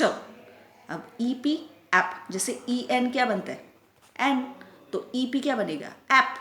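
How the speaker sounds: usual speaking voice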